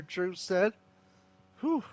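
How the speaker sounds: normal talking voice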